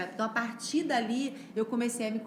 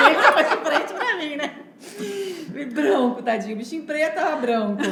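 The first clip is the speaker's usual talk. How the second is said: talking through big laughs; breathless real laughter; laughing; 6 semitones higher, 12 dB louder than usual. Real, not acted. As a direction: laugh out the words.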